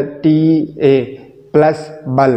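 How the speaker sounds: "टी ए" spelt out slowly, then "बल" as its own syllable; deliberate breaks between "टी ए", "plus" and "बल". Shown as a demonstration. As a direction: slowly build the word from "टी ए" plus "बल". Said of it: "ये वो तरीका है".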